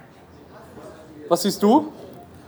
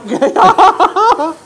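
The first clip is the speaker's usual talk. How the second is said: loud high laugh; 11 semitones higher, 7 dB louder than usual. Real, not acted. Lacht über Avatar. (lautes Lachen)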